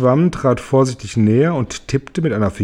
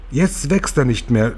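plain reading voice

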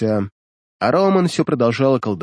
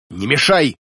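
snapping; 2 semitones higher than usual. A narrator's reading. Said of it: "рявкнула на него Рауман".

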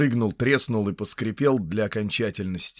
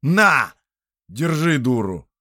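loud; 4 semitones higher, 8 dB louder, slower than usual. Acted. Thrusting a gun at him.

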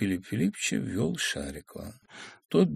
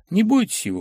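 higher, louder call; calling after; 10 semitones higher, 5 dB louder than usual. Acted. Bormenthal calling after the girl, a raised shout. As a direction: call out after her reassuringly. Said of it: "крикнул вслед Барменталь".

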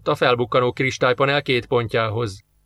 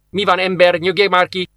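irritated, raised-pitch retort; ingerült rászólás; 5 semitones higher, 3 dB louder than usual. A narrator's reading. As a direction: snap at him to calm down, a bit higher. Indulatos rászólás a jelenetben. (ingerülten rászólva)

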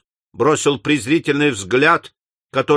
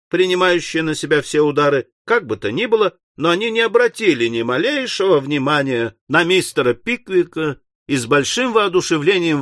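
usual speaking voice

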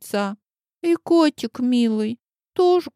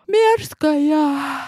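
с зевком; скажи сквозь зевок; зевая; 4 semitones higher than usual. Acted. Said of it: "зевнула Козюлька". (сквозь зевоту)

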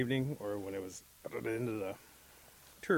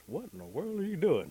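higher, louder incredulous question; baffled Bear; 8 semitones higher, 5 dB louder than usual. A narrator's reading. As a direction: ask in sheer bafflement. Bear puzzled by Rabbit.

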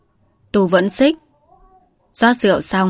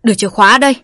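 booming, forceful demand; booming like thunder; 3 semitones higher, 5 dB louder, much faster than usual. A narrator's reading. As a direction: let it boom like thunder. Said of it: "Tu gằn giọng vang như sấm". (gằn giọng vang như sấm)

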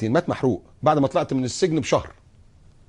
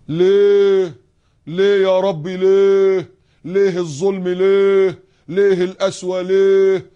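loud drawn-out wailing why; whiny lament; 6 semitones higher, 6 dB louder, much slower than usual. Retold or imitated. Complaining believer wailing at God. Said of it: "بحنية كده".

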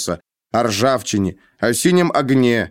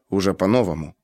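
ordinary delivery

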